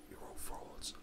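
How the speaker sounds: whispered aside. Real, not acted. Host whispering a jab at wrestling. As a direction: drop to a voiceless aside.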